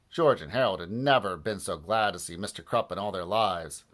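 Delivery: plain reading voice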